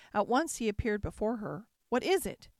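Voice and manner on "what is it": quick, worried question, pitched up and a bit louder; anxious. A narrator's reading. The beast rushes to frightened Beauty, anxious and urgent. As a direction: ask in sudden alarm. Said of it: "asked the beast anxiously".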